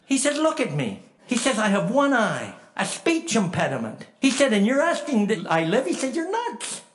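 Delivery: high, loud, exclaimed retort; incredulous protest. Retold or imitated. Dying Cecil rejecting his friend's pity, incredulous and loud. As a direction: protest loudly, voice jumping up.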